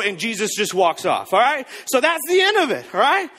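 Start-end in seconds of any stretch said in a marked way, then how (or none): none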